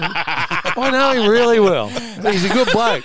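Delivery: laughing through the words, slowed right down; can't hold back the giggle. Retold or imitated. Telling his podcast mates the joke, laughing slowly. Boast retold while cracking up.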